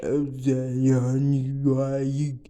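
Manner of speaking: low, groaning mummy voice. Retold or imitated. The fourth horseman speaking like a mummy. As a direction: drone it like a lazy mummy. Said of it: "sounded like a damn mummy".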